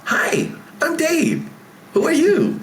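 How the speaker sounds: put-on high, eager little-kid voice; bright child asking who you are; five-year-old kid. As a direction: greet him like a bold five-year-old. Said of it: "Be that five-year-old".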